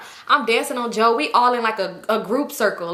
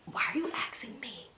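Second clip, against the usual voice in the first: soft breathless whisper, much quieter; whispered aside; 6 semitones higher, 14 dB quieter than usual. Real, not acted. A whispered aside to viewers about the pushy boy. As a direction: lean in and whisper it.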